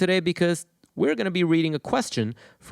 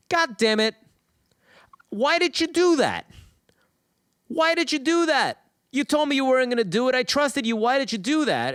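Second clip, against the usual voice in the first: high-pitched outraged protest; betrayed frog; 9 semitones higher than usual. Acted. Sinking frog to the scorpion who stung him, betrayed outrage. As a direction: cry out in acted disbelief, voice pitched way up.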